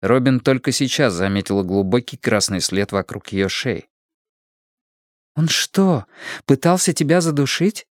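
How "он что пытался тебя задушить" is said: higher-pitched worried question; anxious, voice lifting in concern; alarmed. A narrator's reading. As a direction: ask it in sudden alarm for a friend. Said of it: "встревожилась она".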